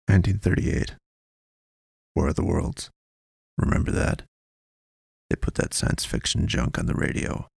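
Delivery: lightly voiced, low and hushed informant; cagey informant. A narrator's reading. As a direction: pass on the tip discreetly, in fragments.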